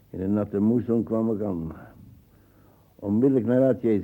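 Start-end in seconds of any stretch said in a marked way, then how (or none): 2.9-4.0 quick, loud barked order, fast; retold loud order. Old man retelling his orders to leave at once, quick loud command. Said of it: "zei ze".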